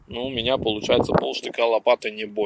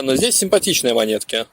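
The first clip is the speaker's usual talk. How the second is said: ordinary talk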